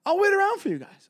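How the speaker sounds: high, eager mock host; annoying host voice. Comic imitating the host offering to wait, high eager voice. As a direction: squeak it out like the clingy host.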